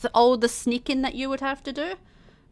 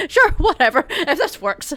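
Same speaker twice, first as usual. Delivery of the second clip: shrugging indifference; 6 semitones higher, 4 dB louder than usual. Acted. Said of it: "shrug and go".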